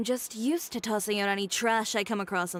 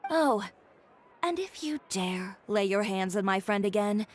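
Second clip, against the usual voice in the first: ordinary delivery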